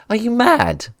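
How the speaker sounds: very high British outburst; Tenth Doctor. Acted. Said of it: "like, David Tennant's Tenth Doctor".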